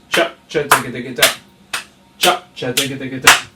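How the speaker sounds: percussive syllables with a rolling fill; rhythmic spoken snare pattern; vocalised drum rhythm. Shown as a demonstration. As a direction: imitate drum strokes by mouth. Drum teacher voices the rhythm.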